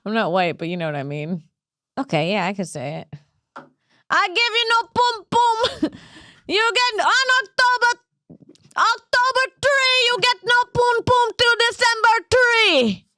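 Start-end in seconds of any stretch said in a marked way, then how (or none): 4.1-5.6 very high, hammed-up Jamaican accent; sustained high mock-Jamaican chant; bad Jamaican accent. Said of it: "This might be the worst Jamaican accent ever". 6.5-13.0 very high, hammed-up Jamaican accent; sustained high mock-Jamaican chant; bad Jamaican accent. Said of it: "This might be the worst Jamaican accent ever".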